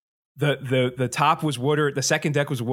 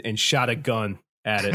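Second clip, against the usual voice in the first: normal talking voice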